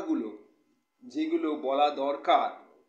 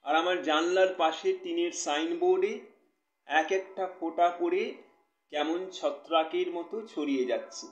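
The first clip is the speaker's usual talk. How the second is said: expressive poetry reading with measured pauses; poem recitation; 2 semitones higher than usual. Acted. Poet reading a new poem to live viewers, expressive recitation. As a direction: recite it line by line, letting key words swell.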